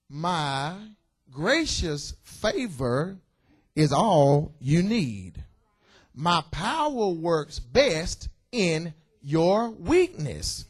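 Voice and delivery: very slow, weighty words of God; solemn scripture reading. Acted. Preacher reading God's reply to Paul, very slow and solemn. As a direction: draw out each word like a divine pronouncement.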